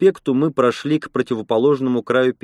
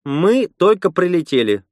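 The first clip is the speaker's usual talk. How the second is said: higher, brisk cut-off reply; curt retort; 6 semitones higher than usual. A narrator's reading. Lena answering the grumbling old man, curt. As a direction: cut him off with a short retort. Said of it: "отрезала Лена".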